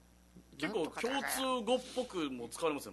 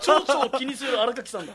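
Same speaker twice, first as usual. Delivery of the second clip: high and loud through laughter; laughing tease; 7 semitones higher, 11 dB louder than usual. Real, not acted. Teasing the guest about butterflies, laughing loudly. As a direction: tease him while laughing.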